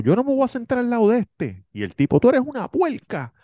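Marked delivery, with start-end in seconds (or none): 0.0-1.7 much higher whiny protest; mock whiny refusal; indignant girlfriend. 2.2-3.3 much higher put-on insult; hammed-up quarrel voice; mock angry man.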